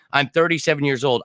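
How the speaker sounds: usual speaking voice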